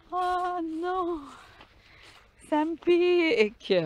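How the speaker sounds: very high squeaky whimper; squeaky mock whine. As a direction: play a tiny squealing victim. Squeaky voice, pricked by burrs.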